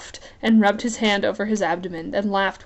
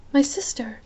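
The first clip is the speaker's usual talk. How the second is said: put-on higher young queen voice; 5 semitones higher than usual. Isabel voice.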